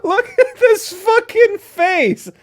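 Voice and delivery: high, loud, slow words through laughter; can't stop laughing while talking. Real, not acted. Reader laughing at image.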